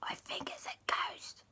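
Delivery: gasping frightened whisper; scared whisper. Acted. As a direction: whisper a warning to auntie. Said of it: "Alexander went".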